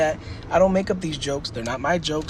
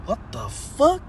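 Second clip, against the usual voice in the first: higher-pitched, baffled question; mock disbelief; 8 semitones higher, slower than usual. Drinker reacts to the failed stunt, mock disbelief. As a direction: voice jumps up in mock shock.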